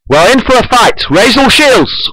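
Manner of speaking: high-pitched battle command; ordering. A narrator's reading. Chekhov readying his ship for a fight, ordering. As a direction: order the crew urgently, voice pushed up high. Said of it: "orders Chekhov".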